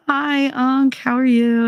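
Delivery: high, bright put-on politeness to the uncle; sugary hello to a relative she dreads; overly sweet greeting. Niece greeting her uncle with forced sweetness.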